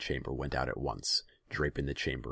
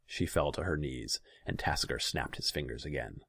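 plain reading voice

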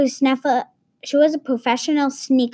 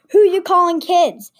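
higher, louder offended yell; angry kids shouting back at the villain; indignant shout; 7 semitones higher, 6 dB louder than usual. Acted. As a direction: snap back, insulted. Spies enraged at villain.

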